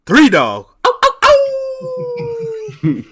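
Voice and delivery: loud high howling yell; Three Dog howl. Acted. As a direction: howl like a wild DJ. Radio host howl.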